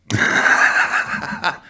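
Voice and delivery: high giggle before reading a cheeky text; a nervous laugh, caught off guard; embarrassed laugh. Real, not acted. Host laughing at a listener's rude text.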